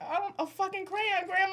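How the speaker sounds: high, mocking kid voice. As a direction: scoff at her like a bratty kid. Kids mocking grandma in story.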